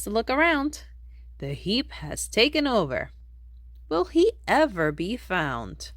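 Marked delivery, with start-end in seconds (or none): none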